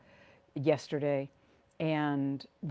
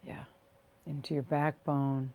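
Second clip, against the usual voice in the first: guided meditation. Real, not acted. Leading listeners to rest and ground through their feet, guided meditation. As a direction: ease them into the body, phrase by unhurried phrase.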